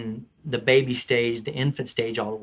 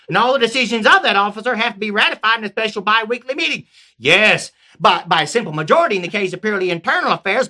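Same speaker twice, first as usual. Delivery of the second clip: Southern peasant; 6 semitones higher, 5 dB louder than usual. Acted. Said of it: "I changed it to a Southern".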